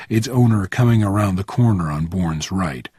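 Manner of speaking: ordinary delivery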